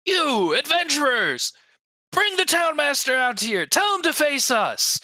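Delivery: high-pitched angry townsperson's cry; calling out. Acted. Townsperson in the mob calling on adventurers, high strained shout. Crowd turns, calls to the adventurers.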